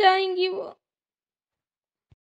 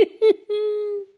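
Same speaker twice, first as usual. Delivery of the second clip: sob; 2 semitones higher than usual. Acted. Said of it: "कैसे रो रही है।".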